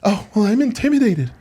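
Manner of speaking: high-pitched mock complaint; mock intimidated. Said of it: "he just felt like".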